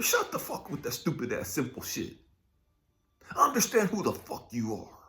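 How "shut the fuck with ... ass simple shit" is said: usual speaking voice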